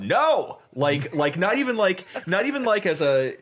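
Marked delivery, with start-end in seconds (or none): 0.0-0.6 high-pitched yelled protest, re-enacted; retold shout. Driver yells as the car goes sideways, retold high shout. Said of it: "all I did was shout".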